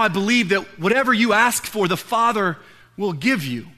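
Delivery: ordinary talk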